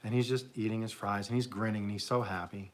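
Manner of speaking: usual speaking voice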